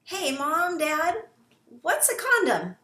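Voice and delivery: put-on high, bright-eyed curious kid voice; child asking. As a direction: play a curious child blurting it out. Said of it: "let's say you're asked".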